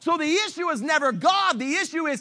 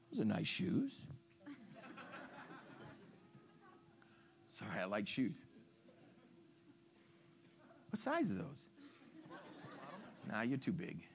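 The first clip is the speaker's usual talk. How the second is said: much softer joking aside; quiet aside; 5 semitones lower, 22 dB quieter than usual. Real, not acted. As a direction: drop to a playful aside.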